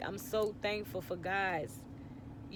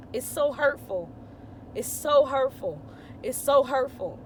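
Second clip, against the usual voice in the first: louder, higher, pained repetition; real anguish building louder; hurt; 5 semitones higher, 7 dB louder than usual. Real, not acted. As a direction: cry it out three times. Repeating her pain, rising emotion.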